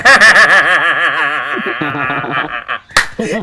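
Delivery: loud happy burst of laughter. Real, not acted. Happy laugh after win.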